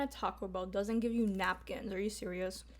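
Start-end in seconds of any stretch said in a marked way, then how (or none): none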